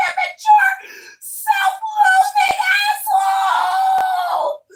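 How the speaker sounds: very high, drawn-out hammed-up screaming; mock hysterical shrieks, stretched out; screaming for help. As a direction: play a hysterical boyfriend wailing for rescue. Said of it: "scream at the top of my lungs".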